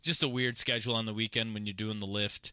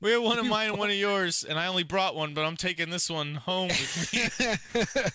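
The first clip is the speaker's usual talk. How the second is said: mock scammer; 7 semitones higher, 4 dB louder than usual. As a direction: sell the lie slyly. Mocking the scamming friend.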